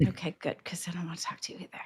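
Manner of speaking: fast snide whisper. Real, not acted. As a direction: hiss it under your breath. Snide aside to a rival.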